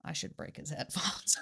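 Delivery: conspiratorial whispered aside; mischievous whispered threat. Real, not acted. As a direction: whisper it like a naughty secret. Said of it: "whispered revenge aside".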